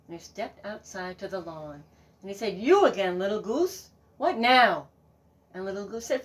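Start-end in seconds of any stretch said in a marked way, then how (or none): 2.6-4.9 loud, weary irritation; annoyed farmer. Acted. Farmer fed up with the goose, loudly annoyed. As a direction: snap at the pest.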